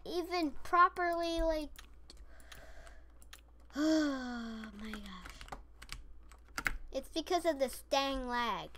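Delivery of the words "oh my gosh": long, slow groan of defeat; exasperated sigh. Real, not acted. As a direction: sigh it out slowly, giving up. Sighs after failing in the game.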